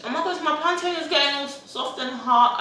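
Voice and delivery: sudden high squeal of surprise; surprised. Real, not acted. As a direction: caught off guard, pitch jumps up. Sudden notice of food.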